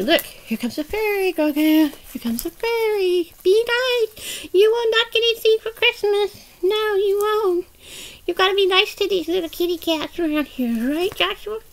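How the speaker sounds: high-pitched scolding fairy; fairy doll. Pretend. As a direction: lecture a naughty toy in a high doll voice.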